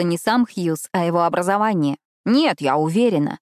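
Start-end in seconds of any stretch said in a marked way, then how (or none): none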